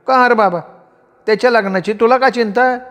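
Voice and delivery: high-pitched motherly teasing; amused mother. A narrator's reading. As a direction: question him lightly, without a real laugh. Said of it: "आई हसत म्हणाली".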